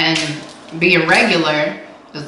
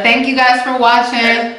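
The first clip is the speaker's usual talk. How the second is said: ordinary talk, nothing marked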